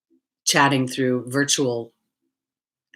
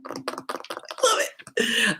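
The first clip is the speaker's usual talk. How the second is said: laughing breathily; 8 semitones higher, 5 dB quieter than usual. Real, not acted. Laughing at own food confession.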